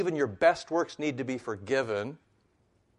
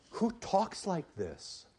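high, drawn-out disbelief; astonished, stretched-out rhetorical question; incredulous; 7 semitones higher, slower than usual. Real, not acted. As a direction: marvel slowly in a raised pitch, falling at the end. Mock disbelief at Luther's bold words.

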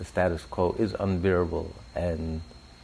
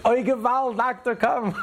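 yelling in Yiddish. Retold or imitated. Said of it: "she yells out in Yiddish".

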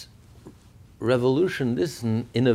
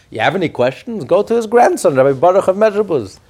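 loud, raised-pitch retort; emphatic brush-off; 6 semitones higher, 12 dB louder than usual. Retold or imitated. Teacher voices the Alter Rebbe deflecting his questioners, loud and emphatic. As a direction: send them off to someone else, loudly.

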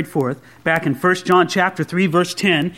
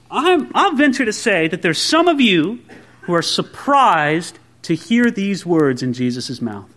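normal talking voice

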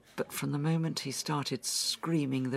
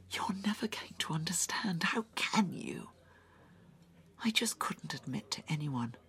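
unvoiced and pained, a little higher; distressed whisper; 4 semitones higher, 4 dB quieter than usual. [name] pleading with Sven to understand, distressed whisper. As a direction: whisper it like it hurts to say.

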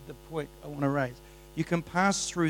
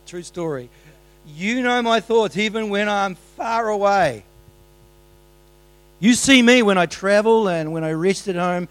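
loud, high psalm proclamation; fervent loud preaching; preaching at full force; 5 semitones higher, 11 dB louder than usual. Acted. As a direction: lift the verse up. Preaching Psalm 139 loudly.

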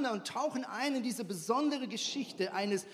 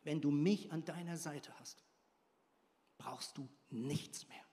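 whispered promise; 5 semitones lower, 6 dB quieter than usual. Shown as a demonstration. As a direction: breathe it out like a secret. Repeats promise, first softly.

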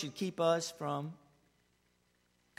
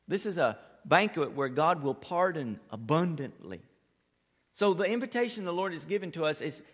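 ordinary talk, nothing marked

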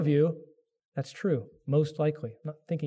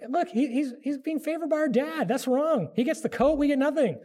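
high, resentful griping of jealous siblings; jealous brothers; 7 semitones higher than usual. Retold or imitated. Joseph's brothers complaining about their father's favouritism, high and whiny. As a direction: gripe like a sulky teenager about the favourite. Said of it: "you can hear the brothers".

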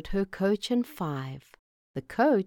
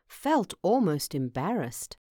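usual speaking voice

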